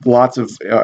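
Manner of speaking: ordinary talk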